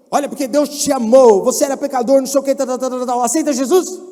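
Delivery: loud, rattled-off canned pitch; scripted street evangelist. Retold or imitated. Mocking Christians preaching a script on the street, loud. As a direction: rush the script like a salesman. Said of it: "a pregar com script".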